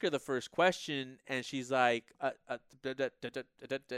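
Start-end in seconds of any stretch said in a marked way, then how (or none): 2.2-4.0 stammering on stuck syllables, softly; imitated stuttering. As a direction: mimic someone tripping over the first sound. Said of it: "kinda stuttering a bit".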